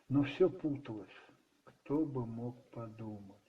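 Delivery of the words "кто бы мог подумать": ordinary delivery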